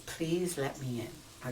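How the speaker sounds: slow explanation. Shown as a demonstration. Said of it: "the context of how he does it".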